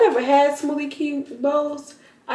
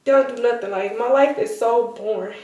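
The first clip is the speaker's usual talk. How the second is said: normal talking voice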